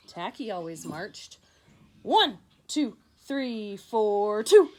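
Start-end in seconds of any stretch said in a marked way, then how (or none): 2.0-4.7 loud, high, jumbled marching count; wild offbeat counting. Acted. Tacky bellows his muddled count as he marches. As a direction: shout the numbers all out of order.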